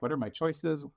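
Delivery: ordinary talk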